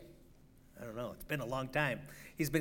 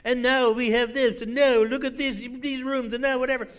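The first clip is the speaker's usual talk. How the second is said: higher, louder mock English announcer; English TV host accent; 6 semitones higher, 5 dB louder than usual. Preacher mimicking an English TV host for his congregation, hammed-up English accent. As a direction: gush like an English celebrity-homes host. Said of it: "that guy that got the English accent".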